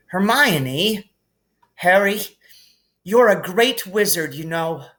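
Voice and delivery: blurting it out while hugging him; emotional Hermione. Acted. Emotional hug.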